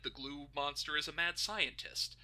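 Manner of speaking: usual speaking voice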